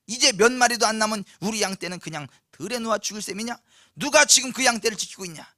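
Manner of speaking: loud, exasperated acted rebuke; angry scolding. Eldest brother accusing David of abandoning the sheep, acted angry scolding. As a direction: tear into him with accusing questions. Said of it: "막 화를 내고 막 분통을 터지면서".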